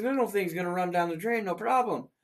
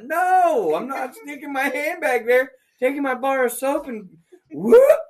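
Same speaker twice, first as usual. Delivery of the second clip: outraged rant; 5 semitones higher, 5 dB louder than usual. Retold or imitated. Mock outraged demo.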